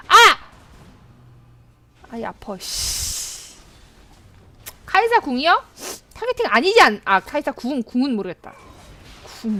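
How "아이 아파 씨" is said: pained mutter. Real, not acted. Got hit.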